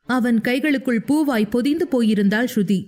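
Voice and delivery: plain reading voice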